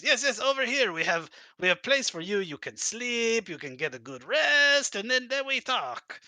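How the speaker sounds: high, fawning broken-English host; dictator voice. Acted. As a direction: coax the guest to rest. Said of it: "this dictator voice".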